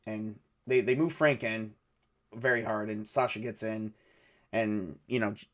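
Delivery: ordinary delivery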